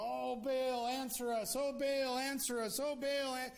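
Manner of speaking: high, loud mock chanting plea; calling out to Baal. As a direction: imitate desperate worshippers crying out on repeat.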